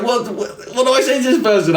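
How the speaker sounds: high, fast, panicky inner voice; as if you can't find the words. Retold or imitated.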